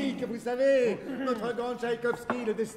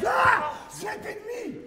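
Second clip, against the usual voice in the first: triumphant shout; 12 semitones higher than usual. Acted. Gambler triumphs at the card table.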